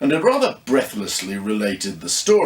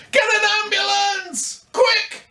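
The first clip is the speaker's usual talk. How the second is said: much higher shouting, urgent alarm; panicked scream. Acted. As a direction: yell for help, terrified. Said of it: "Then she screamed".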